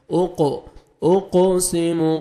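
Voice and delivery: "اقسم" slow, with a bouncing echo on the qaf; qalqala echo. Shown as a demonstration. Teacher shows the double sound, echoing qaf in "اقسم". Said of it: "double sound آئے گا".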